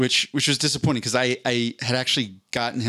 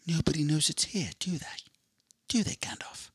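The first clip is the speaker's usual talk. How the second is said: hushed, higher, fading into a whisper as Frodo; a secretive Frodo asking Gandalf under his breath; Frodo impression; 5 semitones higher, 7 dB quieter than usual. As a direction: play a frightened hobbit who drops to a whisper. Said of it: "That was my Frodo impression".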